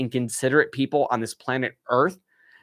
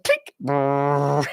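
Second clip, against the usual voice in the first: click and buzz. Retold or imitated. Narrator mimics the motion light kicking on, click and buzz. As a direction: mouth click then imitated buzz. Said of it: "like, light buzz".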